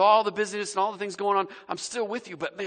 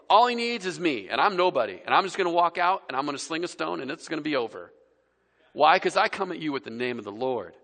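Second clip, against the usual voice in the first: ordinary talk, nothing marked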